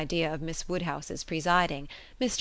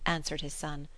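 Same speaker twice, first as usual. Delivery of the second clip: usual speaking voice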